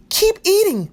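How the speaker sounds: high, loud scolding. A narrator's reading. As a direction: scold them to keep going. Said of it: "Maria scolded".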